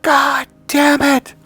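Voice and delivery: high, drawn-out enraged screaming; mother's furious scream. Retold or imitated. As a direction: scream it slowly, full force, as an angry mother. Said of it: "and she screams".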